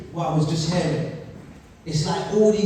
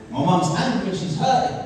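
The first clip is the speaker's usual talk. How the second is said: ordinary talk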